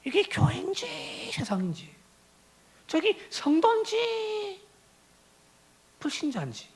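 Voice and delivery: very high, thin confused musing; baffled. Acted. Demon eyeing the congregation, confused and high-pitched. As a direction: puzzle aloud in a high, stretched-out voice. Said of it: "헷갈려 한단 말이에요".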